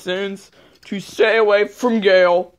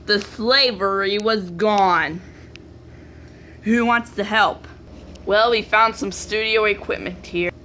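normal talking voice

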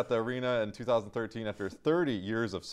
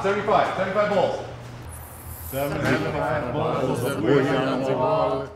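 put-on German accent; 3 semitones higher, 4 dB louder than usual. Sketch actors retake the booyah song in a German accent. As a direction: sing the count in a broad mock German accent. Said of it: "German accent".

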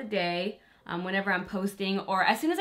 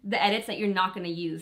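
normal talking voice

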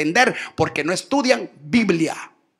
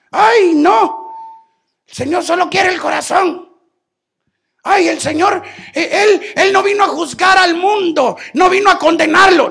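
high whiny mock protest, louder; mocking the critics; 9 semitones higher, 7 dB louder than usual. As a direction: parody a shocked churchgoer protesting.

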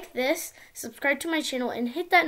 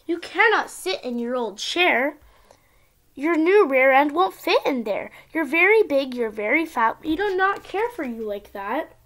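sing-song storybook reading; reading to a toddler; 6 dB louder than usual. Acted. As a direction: read it like a bedtime picture book. Child reads picture book aloud.

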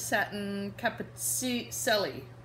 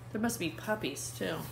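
plain reading voice

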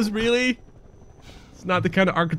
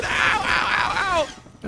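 pained yelps; 11 semitones higher, slower than usual. Real, not acted. Taking damage.

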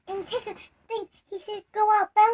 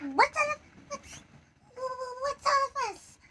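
high, soft, timid little voice; very small voice; 7 semitones higher, 7 dB quieter than usual. Acted. Piglet timidly asking the arguing friends, small and soft. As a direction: ask it tiny and shy. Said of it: "asked a very small voice".

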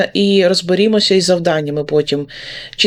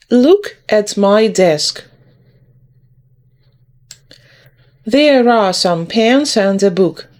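line-by-line poem reading. Shown as a demonstration. Teacher reads the desk poem for pupils to repeat, measured reading. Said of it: "буду читати по рядочку".

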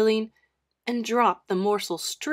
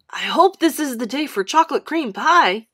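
higher, louder, eager hope; excited boy; 4 semitones higher, 8 dB louder than usual. A narrator's reading. Boy hoping aloud for chocolate cream pie, excited. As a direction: bubble over about a favourite treat.

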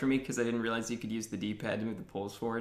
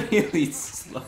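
laughing; 7 semitones higher, 8 dB louder, slower than usual. Real, not acted. Laughing at slow horse.

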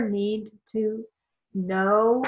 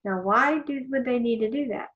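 mock wondering question; 4 semitones higher than usual. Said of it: "you think".

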